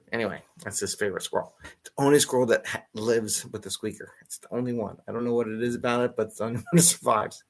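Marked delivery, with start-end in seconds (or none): none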